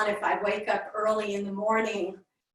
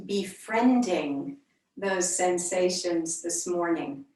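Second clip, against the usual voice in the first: unhurried meditation voice with long pauses between phrases; yoga teacher leading a calm body scan; guided meditation. Real, not acted. Leads a centering meditation.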